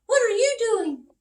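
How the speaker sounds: high, loud birdlike chorus voice; high birds voice; chirpy birds. Acted. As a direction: sing it out as a flock of birds. Birds voiced in a read story.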